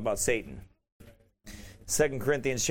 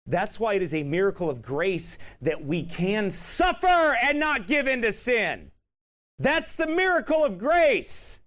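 preaching at full force; 4 semitones higher than usual. Acted. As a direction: thunder it from the pulpit. Preacher rising to a climax.

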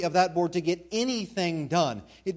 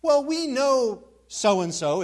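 lightly voiced churchgoer, higher and louder; slow, drawn-out start to a mimicked excuse; excuse-making churchgoers; 7 semitones higher, 6 dB louder, much slower than usual. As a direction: imitate people making allowances for someone. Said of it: "you often hear people say something like this".

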